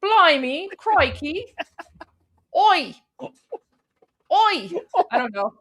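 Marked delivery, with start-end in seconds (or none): 0.0-1.4 high, hammed-up British exclamations; badly faked British accent, pitched way up; terrible British accent. Shown as a demonstration. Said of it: "I have a terrible British accent". 2.6-3.3 high mock British "oi"; badly faked British accent, calling out; hammed-up British heckle; terrible British accent. Shown as a demonstration. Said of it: "I have a terrible British accent".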